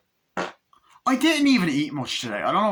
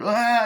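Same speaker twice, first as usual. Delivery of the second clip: sharp yelp, higher and louder; 11 semitones higher, 6 dB louder than usual. Retold or imitated. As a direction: cry out in fright. Boy cries out, hiding.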